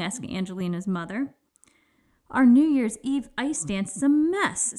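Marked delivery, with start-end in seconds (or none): 2.3-4.7 higher, louder, lightly upset complaint; a touch of plaintive dismay; mildly sad. A narrator's reading. Daughter to mother about the ruined ice dance, mildly sad. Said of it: "said Angelina sadly".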